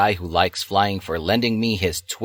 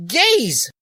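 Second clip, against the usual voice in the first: much higher shouted taunt; mock shout; 3 dB louder than usual. Dali shouting at the boys, a high mock shout. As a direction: fling it as a high yell. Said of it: "Dali shouted".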